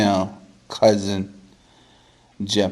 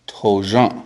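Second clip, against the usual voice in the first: ordinary delivery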